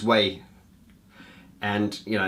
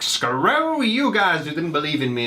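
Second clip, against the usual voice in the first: slow, louder, higher defiant cry; defiant triumph; 7 semitones higher, 4 dB louder than usual. Retold or imitated. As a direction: fling it back at the doubters, slow and raised. Voicing the triumphant encore feeling.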